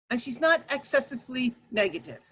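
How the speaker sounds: ordinary talk, nothing marked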